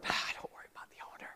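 hushed conspiratorial whisper. Acted. Friend on the roof whispering to the others, brushing off the owner. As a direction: lean in and whisper it fast.

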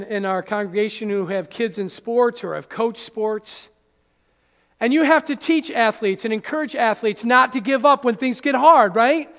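normal talking voice

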